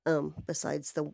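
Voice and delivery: ordinary delivery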